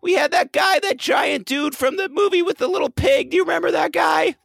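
frantic shouting caller. Pretend. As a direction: yell down the phone.

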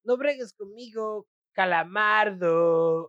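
Spanish dub line. Retold or imitated. Spanish SpongeBob line quoted.

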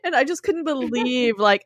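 normal talking voice

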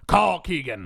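loud, high comic yell; insistent mock shout. Travolta insisting to his lawyer from prison, mock shout. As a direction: shout it over the lawyer's objection.

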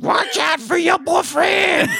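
high, drawn-out shout in mock French accent; bad French accent. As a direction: yell it in a hammed-up French accent. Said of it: "Him trying to do a French accent".

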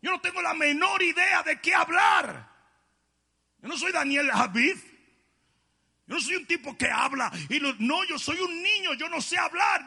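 put-on high, loud pleading child-like protest; Jeremiah protesting. Jeremiah pleading with God that he is only a child, high and loud. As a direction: cry out that you're only a boy. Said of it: "Y Jeremías dice".

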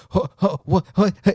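high, stammered sputters of disbelief; mock flabbergasted. Manager blindsided by the cleaner quitting, mock flabbergasted stammer. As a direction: imitate a boss lost for words. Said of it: "He was not expecting that".

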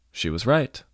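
usual speaking voice

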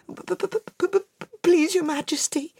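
high, stuttering, shaky plea; terrified stammer. Acted. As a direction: stammer in terror before the king.

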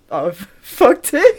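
laughing through the words; breaking up mid-sentence. Real, not acted. Laughing at own mistake.

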